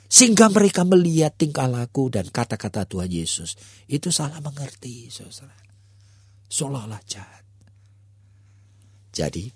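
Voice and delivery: usual speaking voice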